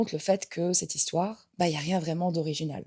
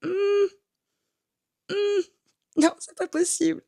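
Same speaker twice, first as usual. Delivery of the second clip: high, doubtful hums, then a disbelieving no; mock-sceptical humming at a joke that falls flat; sceptical hmm; 13 semitones higher, slower than usual. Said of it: "je suis là en mode". (hum sceptique très aigu, puis un non incrédule)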